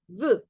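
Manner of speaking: V said as one short burst; short sound. Shown as a demonstration. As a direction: clip the "v" to a short syllable. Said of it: "y un sonido corto". ("v" con sonido corto)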